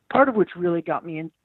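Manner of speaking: usual speaking voice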